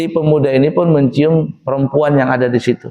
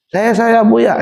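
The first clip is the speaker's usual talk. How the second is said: eager pilgrims; 6 semitones higher, slower than usual. Acted.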